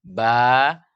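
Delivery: long ba with a light vowel; thin, open ba. Shown as a demonstration. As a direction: sustain a clear thin ba. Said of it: "kita baca ba".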